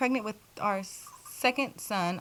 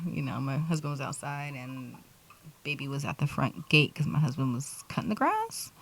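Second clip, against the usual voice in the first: ordinary talk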